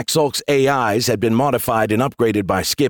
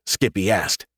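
plain reading voice

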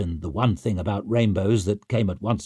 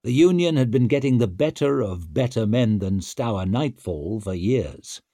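usual speaking voice

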